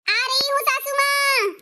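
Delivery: high, slow call to another room. Acted. Daughter-in-law calls back to her mother-in-law, high and drawn out. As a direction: stretch it out to reach the mother-in-law.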